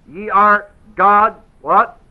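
"Ye are God's what" asked slow with a rising end; slow prompting question. Shown as a demonstration. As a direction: draw the answer out of the class.

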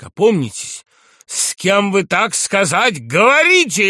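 high, loud, outraged rebuke; furious shout. A narrator's reading. General rebukes the insolent young man, furious shout. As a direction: shout indignantly, slowing on the question. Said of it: "яростно закричал Иван Ильич".

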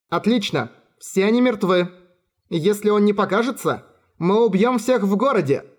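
high, gleeful threatening shout; dark elf girl shouting. A narrator's reading.

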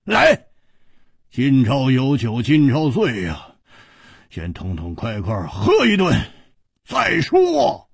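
hearty hero urging everyone to drink; Hu Tiehua, reckless bravado. Acted. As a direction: shrug off death and call for wine.

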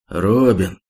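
ordinary delivery

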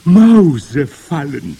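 slow, loud, high groan of a word; long drawn-out moan. A narrator's reading. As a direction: moan it out as the witch crowd. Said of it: "stöhnten die Hexen".

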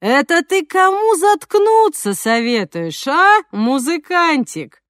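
slow, sneering street thug; menacing thug voice. Acted. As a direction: drawl it like a yard bully picking a fight. Thug Zhokh threatening.

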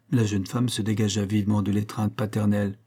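plain reading voice

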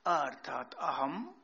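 normal talking voice

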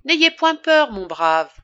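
country doctor putting on a bold, reassuring voice. A narrator's reading. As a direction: call out comfort to the peasant. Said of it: "s'adressant au villageois".

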